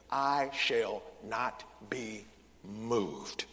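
very slow, word by word; low, deliberate recitation; weighty declaration. Acted. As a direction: pause after each word of the verse. Quotes verse with weighty pauses.

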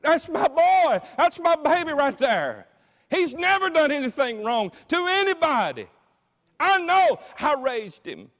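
high, loud mother's wail; tearful outcry. Acted. Mary crying out for her son at the cross, anguished shout. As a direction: cry out in protest, stretching the key words. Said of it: "Mary begins to cry with big crocodile tears".

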